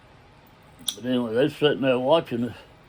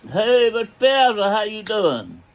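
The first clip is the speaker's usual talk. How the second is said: higher, louder call across the distance; hollered greeting; 8 semitones higher, 3 dB louder than usual. Retold or imitated. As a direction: holler out a friendly hello, retold. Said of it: "I holler".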